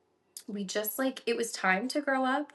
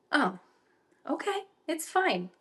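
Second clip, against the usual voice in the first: unbothered husband; 7 semitones higher than usual. Retold or imitated. Sleepy husband's reaction to pregnancy news.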